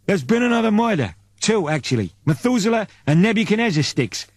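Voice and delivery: a worried subordinate reporting; Apton Sticks voice. Acted.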